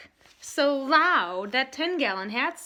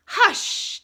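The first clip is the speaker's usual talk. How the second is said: sharp whispered hush; scolding hush; 10 semitones higher, 7 dB louder than usual. Acted. Daddy scolds his loud son with a sharp hush. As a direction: hush him sternly. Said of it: "Daddy scolded".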